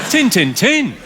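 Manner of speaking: Yorkshire accent on three short words. Shown as a demonstration. Comic repeating the favourite phrase, Yorkshire accent. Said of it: "My favourite Yorkshire phrase".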